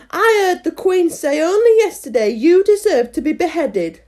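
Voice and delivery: high, loud acted accusation; quarrelling gardener. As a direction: taunt a workmate in a squabble. Gardeners quarrel, Five accuses Seven.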